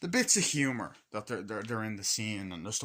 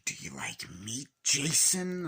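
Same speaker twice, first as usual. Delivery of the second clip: Ghostface voice; 3 semitones higher, 4 dB louder, slower than usual. Retold or imitated. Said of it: "Ghostface being like".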